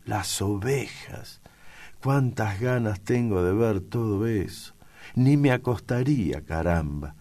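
put-on high, childlike excitement; boyish delight. A narrator's reading. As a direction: murmur that grows into eager, high exclamation. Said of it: "murmura con pueril alborozo".

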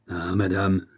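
usual speaking voice

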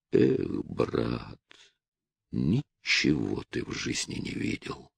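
soft, heavy-hearted sigh of a line; quiet, sorrowful resignation; anguished. A narrator's reading. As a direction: sink into longing, voice dropping away. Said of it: "с тоской произнёс парень".

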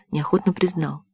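ordinary delivery, nothing marked

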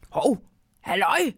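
captain's mock holler; storybook shout, kept moderate; calling out. A narrator's reading. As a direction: hail someone across the waves. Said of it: "råbte kaptajnen".

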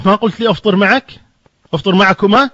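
sharp indignant reproach, acted lightly; indignant retold question. Host asks the late sheikh why he never came, mildly indignant.

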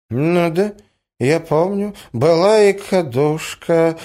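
absent-minded mumbling. A narrator's reading. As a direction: mumble it half-aware. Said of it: "пробормотал бессознательно князь". (рассеянно бормотать, припоминая)

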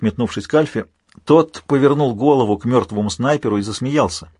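ordinary delivery, nothing marked

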